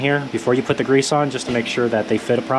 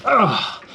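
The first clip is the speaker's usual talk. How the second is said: effort grunt; 11 semitones higher than usual. Real, not acted. Straining on a breaker bar.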